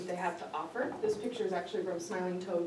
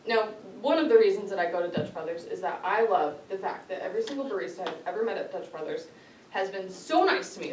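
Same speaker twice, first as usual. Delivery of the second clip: ordinary talk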